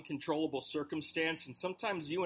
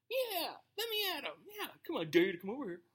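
much higher, mock-tough taunt; tough guy taunting; 7 semitones higher than usual. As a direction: puff up like a bravado online fighter daring someone. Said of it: "you get real tough".

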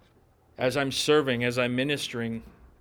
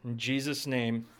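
closing prayer; 3 semitones lower than usual. Acted.

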